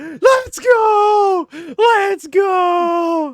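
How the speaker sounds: cheering shout. Retold or imitated. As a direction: scream it with joy. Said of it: "And I went".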